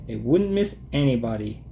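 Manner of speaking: ordinary delivery, nothing marked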